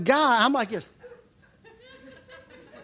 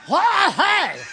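much higher, louder burst of babble; mock shout; 8 semitones higher, 5 dB louder than usual. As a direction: blurt it out as if tongues. Forcing out tongues as told.

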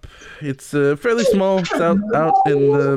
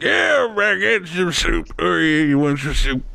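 dwarf Oleg. Acted. Dwarf cook offering the party soup, lightly voiced. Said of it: "Oleg's gonna be like".

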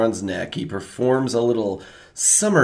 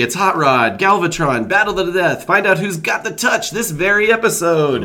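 higher, hyped TV promo read; announcer voice; 8 semitones higher, 3 dB louder than usual. Acted. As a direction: announce it like a trailer. Reads episode title card.

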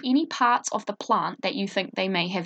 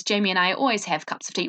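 normal talking voice

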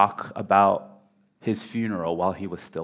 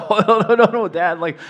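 much higher and louder, an exasperated daughter; a grown daughter scolding her father in disbelief; daughter's protest; 10 semitones higher, 8 dB louder than usual. Retold or imitated. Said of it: "The daughter was like".

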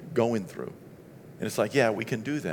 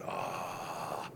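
1 reluctant groan; 7 semitones lower, 13 dB quieter than usual. Retold or imitated. Reluctant sigh to the Lord.

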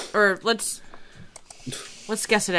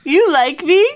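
surprised; 8 semitones higher, 11 dB louder than usual. Pretend. Teasing surprise.

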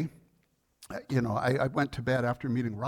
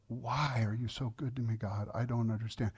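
hushed, overcome with emotion; broken prayer; 2 semitones lower, 6 dB quieter than usual. Retold or imitated. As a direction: pray softly as if breaking down. Broken, weeping prayer to God.

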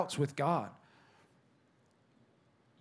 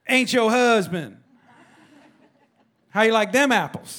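loud, higher, sassy preacher Jesus; sassy call-out; 9 semitones higher, 11 dB louder than usual. Acted. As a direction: belt it out like a gotcha punchline. Jesus voiced calling her out.

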